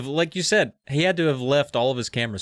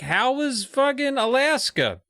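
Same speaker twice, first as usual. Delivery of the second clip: high, slow nasal whine; Lauren; 12 semitones higher, slower than usual. Acted. Visiting friend asks the kids about Alaska, high nasal voice. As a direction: put on a nasally high-pitched girl voice. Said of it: "Nasally. High nasal voice".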